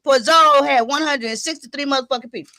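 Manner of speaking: normal talking voice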